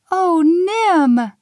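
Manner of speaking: high, loud exclamation; cried out. Acted. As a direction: cry out his name in dismay and delight. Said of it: "Lil cried".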